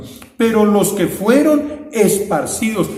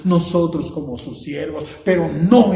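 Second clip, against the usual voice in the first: normal talking voice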